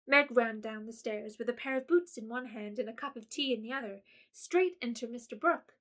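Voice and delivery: ordinary delivery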